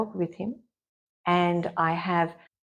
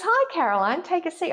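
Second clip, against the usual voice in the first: bright, high, friendly doctor's greeting; imitating the doctor's upbeat welcome; cheery doctor; 10 semitones higher, 3 dB louder than usual. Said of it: "and he goes".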